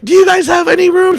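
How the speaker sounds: calling another hospital for a bed; eager caller. Retold or imitated. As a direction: ask it hopefully on the phone. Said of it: "I'm like".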